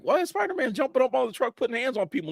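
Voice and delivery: high, fast incredulous question; baffled reaction. Retold or imitated. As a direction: blurt it out in high-pitched disbelief. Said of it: "I'm like".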